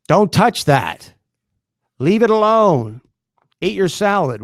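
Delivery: high loud scolding mother; scolding mom. Retold or imitated. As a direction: yell like a mom at a kid. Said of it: "I'm the guy that mom yells at".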